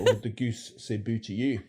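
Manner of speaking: Scottish accent, fronted "boo". Shown as a demonstration. Presenter showing regional vowels, in Scottish. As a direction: say it like a Scot.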